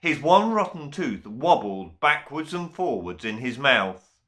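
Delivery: plain reading voice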